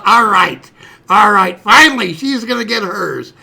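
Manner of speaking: very loud, acted-out jubilation; triumphant gloating. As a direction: cheer your rival's downfall, bursting out. Boy cheering sister's coming punishment.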